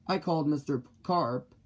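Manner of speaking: plain reading voice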